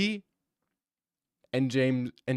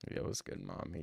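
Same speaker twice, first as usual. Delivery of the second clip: hushed, barely voiced mock come-on; softly imitated pickup line; mock flirty texter; 7 semitones lower, 12 dB quieter than usual.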